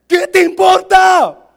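very loud, high, stretched-out retort; hammed-up slow yell; mock shout. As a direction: bellow each word slowly for comic effect. Repeats it as a shout.

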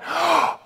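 sharp mock gasp of delight; flattered gasp. Pastor voicing Hezekiah, a flattered little gasp. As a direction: gasp like someone thrilled to be noticed. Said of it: "Here's Hezekiah going".

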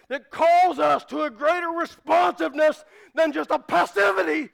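emphatic preacher, pitch held high; fervent preaching. Real, not acted. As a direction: press each point with urgent conviction. Preaches at full force.

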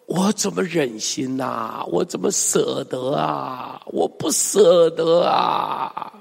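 slow, aching reluctance; sighing lament. Acted. Preacher models reading God's reluctance, lamenting. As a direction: drag out each line, swelling on the last. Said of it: "你都要用这种语气来读".

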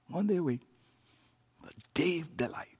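breathless hushed whisper, quick; soft whisper of delight. Acted. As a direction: let it slip out as a secret. Savouring the Sabbath idea.

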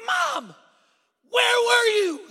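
high, very loud acted wail; slow, stretched-out shout of reproach; overwrought yell. Boy finding his missing mom at last, overwrought yell.

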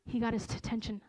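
normal talking voice

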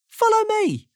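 very high, chirpy command; cheery high summons; Mister Happy. A narrator's reading. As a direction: call it out in a high, decisive voice. Mister Happy takes charge.

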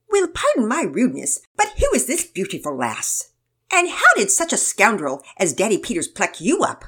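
loud, mock-gallant British voice; theatrical British charmer; British accent. A narrator's reading. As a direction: flatter her in a hammed-up British accent. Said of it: "to a British accent".

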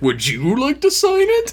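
high, singsong retold invitation; imitated needling question; mock-polite offer. Retold teasing offer to angry rival.

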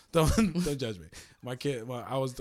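normal talking voice

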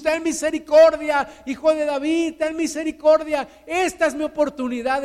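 loud, high, urgent pleading; shouting. Acted. Blind beggar calling to passing Jesus, urgent shouting. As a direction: shout for mercy as your one chance. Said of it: "empieza a gritarle a Jesús".

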